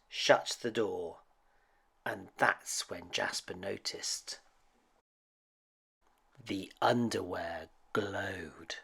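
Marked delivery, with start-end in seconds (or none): 6.5-8.8 hushed and slow, let it creep; slow eerie hush; spooky whisper. A narrator's reading. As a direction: whisper it like a ghost story secret. Spooky reveal in bedtime story.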